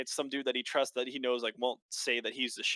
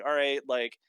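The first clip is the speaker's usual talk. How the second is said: usual speaking voice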